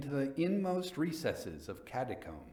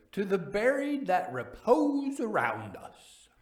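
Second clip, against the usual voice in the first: put-on drunken toast voice; 9 semitones higher, 5 dB louder than usual. A narrator's reading. Fortunato's toast.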